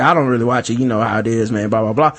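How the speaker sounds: low mock casual denial; offhand dude impression. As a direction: play it cool like you don't care. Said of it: "he was like".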